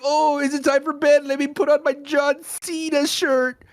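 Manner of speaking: high-pitched, drawn-out teasing mimicry; mocking imitation. Mimicking co-host going to bed.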